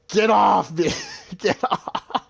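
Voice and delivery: imitating a furious driver's shout; mock yell. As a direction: yell it like he's shoving someone away. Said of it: "he's just yelling".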